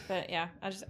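usual speaking voice

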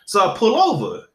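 ordinary talk, nothing marked

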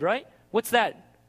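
ordinary talk, nothing marked

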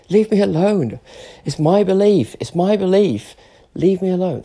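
high, insistent pleading irritation; exasperated protest. Real, not acted. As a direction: fend them off, fed up. Protesting at critics.

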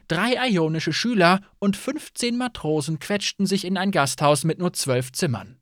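usual speaking voice

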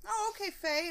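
high mock clerk voice. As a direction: mimic the clerk misreading, high. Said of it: "They're like".

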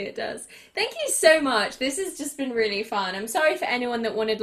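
ordinary talk

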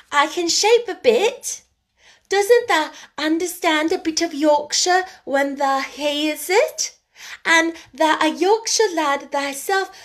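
girl mocking a Yorkshire lad in dialect; broad Yorkshire teasing. A narrator's reading. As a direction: tease him in thick Yorkshire. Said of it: "answered Mary triumphantly".

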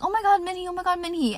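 put-on high, squealing teen-girl gush; older girls doting in the hallway, high gushing; gushing popular girl. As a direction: coo over a cute little kid. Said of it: "these older popular girls would always be like".

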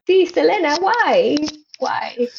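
high, playful whine at Selena; mock complaint. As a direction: plead why in pretend exasperation.

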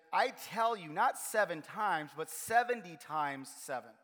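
slow and weighty, leaning on "not" and "seventy"; emphatic answer. Acted. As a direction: land "seventy times seven" slowly, each word firm. Jesus' answer read with weight.

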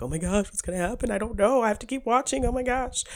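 high, rushed viewer panic rising as it goes; mock suspense. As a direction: play a viewer on the edge of the seat. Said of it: "extra layer of excitement".

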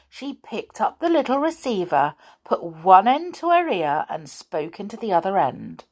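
ordinary delivery

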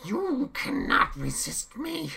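loud, very high screaming rage. A narrator's reading. Chaos screams at Jim against the wall. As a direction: scream it in his face. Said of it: "he screamed".

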